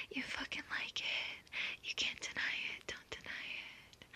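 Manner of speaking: soft, insistent whisper. Acted. Intimate insistence to listener.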